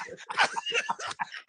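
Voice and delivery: high breathy cackle of disbelief; high laugh. Real, not acted. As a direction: laugh it off, squealing. Laughing after the scare.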